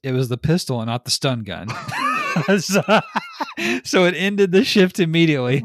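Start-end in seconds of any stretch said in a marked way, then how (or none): 1.7-2.5 high shriek of laughter; real laughter; loud laugh. Real, not acted. Laughing at the story. 2.6-5.6 much higher, louder, laughing; amused retelling through laughter. Real, not acted. Laughing at the story.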